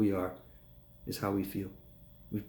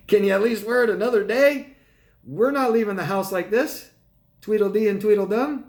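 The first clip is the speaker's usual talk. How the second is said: higher, louder half-laughing exasperation; exasperated plea; 7 semitones higher, 5 dB louder than usual. Retold or imitated. To the friend dressed identically, exasperated and higher. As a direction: plead with him like it's absurd. Begging friend to change outfit.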